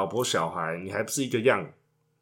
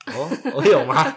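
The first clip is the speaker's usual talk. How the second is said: laughing; 2 semitones higher, 6 dB louder than usual. Real, not acted. Teased about bedroom frequency.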